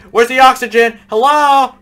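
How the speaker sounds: high frantic searching; rising panic, calling out; panicked. Real, not acted. Panic building while searching.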